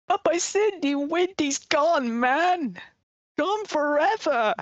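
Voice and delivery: slow, drawn-out mournful moan; mournful lament.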